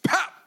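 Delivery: high squeaky pop sound; sudden high pop. Acted. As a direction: pop it out like a cartoon. Popping sound effect in story.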